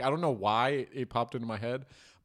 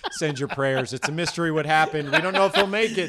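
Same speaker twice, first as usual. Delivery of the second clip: laughing; 4 semitones higher, 7 dB louder than usual. Real, not acted. Mock grave joke about Tony.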